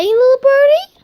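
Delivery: put-on squeaky high baby-talk voice. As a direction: coo it up high and loud. Squeaky call to the bird.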